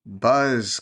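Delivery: a hint of a drowsy insect; buzzing fly. A narrator's reading. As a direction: hum the word like a little fly. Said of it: "said something mysterious that sounded like".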